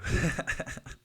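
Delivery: high, breathy chuckle; short laugh. Real, not acted. Laughing after brushing off the Super Bowl, amused. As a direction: chuckle at your own joke.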